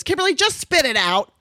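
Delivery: mother losing patience on the phone; impatient mom. Retold or imitated. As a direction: snap at her daughter to get on with it. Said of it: "'Cause she's not very patient".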